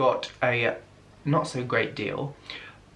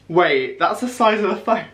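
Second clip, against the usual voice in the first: surprised; 6 semitones higher, 5 dB louder than usual. Real, not acted. Repeats size shock.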